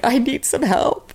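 high, slow, tearful plea for help; crying plea. Retold or imitated. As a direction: let the voice break on "help". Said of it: "and I'm just crying".

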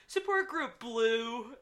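high, drawn-out narrator imitation; imitating the narrator. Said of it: "she was saying things like".

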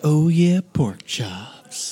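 breathy sultry whisper. Retold or imitated. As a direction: purr it like a sexy ad voice. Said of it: "She's just like".

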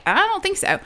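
high, soft, hesitant reply; acting out a polite, unsure no; doubtful. Retold doubtful answer to the man.

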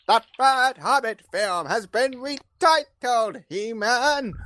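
high, slow hammy villain; Skeletor. Acted. Reading trending news as a cartoon villain, high and slow. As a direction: draw it out high and theatrical. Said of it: "I think Skeletor voice is the way".

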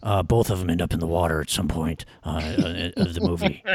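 low, mumbled impression with halting starts; mumbling movie actor. Co-host mocking the actor's delivery, mumbling low. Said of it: "he's mumbling his ass off".

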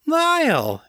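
very high, delighted squeal of greeting; happy cry. A narrator's reading. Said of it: "she cried happily".

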